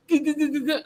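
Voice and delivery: high mechanical gah sound; printer noise. Retold or imitated. Retelling his printer jamming, mock high grinding noise.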